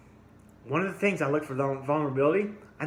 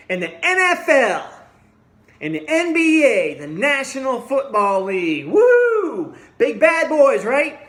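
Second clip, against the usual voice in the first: louder and higher, a full angry roar; furious shouting; 9 semitones higher, 8 dB louder than usual. Real, not acted. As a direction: bellow it out, real outrage. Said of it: "but I'm really pissed off about it".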